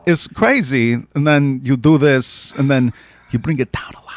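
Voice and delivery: slow halting Walken cadence; Christopher Walken impression. Shown as a demonstration. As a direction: Walken pauses, then drop it. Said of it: "the whisper of walk in".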